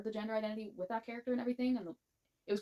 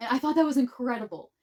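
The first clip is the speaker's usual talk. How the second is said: loud, higher burst of enthusiasm; excited praise; 6 semitones higher, 11 dB louder than usual. Real, not acted. Gushing about the book.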